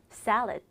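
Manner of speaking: salad as two syllables, SAL-ed; English pronunciation of salad. Shown as a demonstration. Teacher modelling salad for students, English pronunciation. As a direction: salad stressed first, short final d. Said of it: "샐러드는 잘못된 발음".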